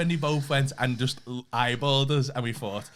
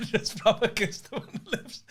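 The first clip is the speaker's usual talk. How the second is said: ordinary talk, nothing marked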